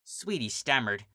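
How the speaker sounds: ordinary delivery, nothing marked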